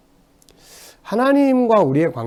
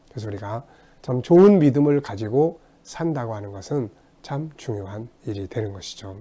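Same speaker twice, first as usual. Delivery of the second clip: ordinary talk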